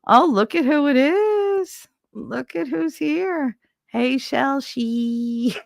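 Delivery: high, slow sing-song welcome; drawn-out hey of delight; delighted greeting. Real, not acted. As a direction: coo over who just arrived. Delighted greeting of arriving viewer.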